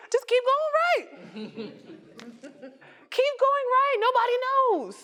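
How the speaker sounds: playful. Acted. Advising speaker who went wrong.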